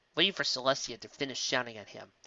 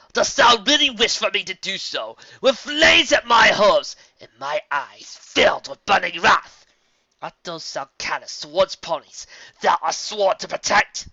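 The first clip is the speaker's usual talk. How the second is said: wrathful threat; 7 semitones higher, 12 dB louder than usual. Acted.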